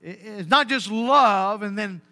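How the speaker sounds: ordinary delivery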